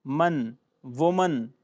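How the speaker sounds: "मन, वुमन" drawn out slowly; slow pronunciation demo. Shown as a demonstration. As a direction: model "वुमन" with a short muhn ending. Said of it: "वो".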